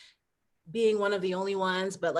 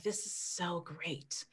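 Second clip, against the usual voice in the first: softer, slow hint of a West African accent; hint of his accent; 12 dB quieter, much slower than usual. Wife quoting husband in Vermont, faint West African accent. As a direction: barely put on his West African accent, then drop it. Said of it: "he would say it in his accent".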